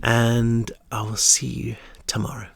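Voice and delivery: ordinary delivery, nothing marked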